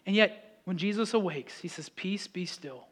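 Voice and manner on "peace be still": hushed, soft and even; quiet, settling authority; calming command. Acted. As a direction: still the storm gently. Jesus calms storm, dropped voice.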